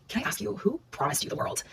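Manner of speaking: very soft, careful question; hushed confrontation. Retold or imitated. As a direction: ask it gently, barely above a murmur. Hesitant confrontation retold in the car.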